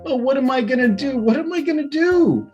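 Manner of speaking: high, worried fretting; frightened whimper. A narrator's reading. Wilbur worrying, frightened.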